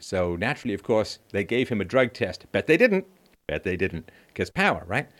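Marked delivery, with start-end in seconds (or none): 2.5-3.0 high mocking sarcasm, loud; sarcastic high. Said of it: "repeated flat after". 3.5-4.1 low, soft, dry deadpan. Pretend. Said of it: "repeated high before".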